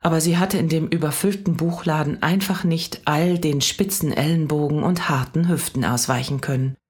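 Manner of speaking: ordinary delivery, nothing marked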